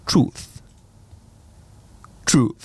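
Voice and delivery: "Truth" said with a self-conscious put-on English accent; careful, slightly awkward English accent on the word "Truth". Shown as a demonstration. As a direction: try your best English accent on "Truth", a bit hammed-up. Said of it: "Je prends mon plus bel accent anglais".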